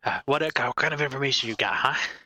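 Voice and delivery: put-on eager old man. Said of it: "As he goes".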